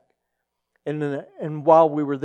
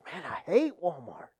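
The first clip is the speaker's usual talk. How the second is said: soft, put-on grumble; reluctant grumble; 10 dB quieter than usual. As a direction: imitate quiet, resigned complaining. Pastor reluctantly grumbles about errand.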